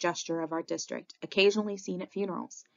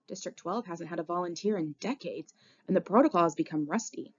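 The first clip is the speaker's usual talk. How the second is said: ordinary delivery, nothing marked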